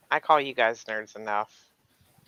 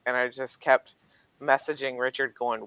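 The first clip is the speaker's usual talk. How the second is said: usual speaking voice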